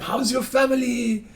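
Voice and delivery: put-on high, drawn-out French-accented question. French caller making small talk before a favor, French accent. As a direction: imitate the Frenchman, slow and high. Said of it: "he had a French accent".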